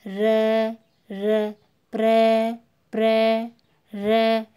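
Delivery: "र" and "प्र" drawn out loudly, on a level tone; sound demonstration. Shown as a demonstration. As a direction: hold the ending of "प्र" to expose "र". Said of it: "अंत में आवाज़ आती है". ("प्र" और "र" की लंबी, ज़ोरदार आवाज़)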